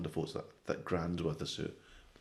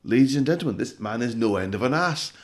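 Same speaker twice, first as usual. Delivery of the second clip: higher, louder mocking announcement; clown ringmaster voice; 7 semitones higher, 10 dB louder than usual. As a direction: announce it like a jeering circus clown.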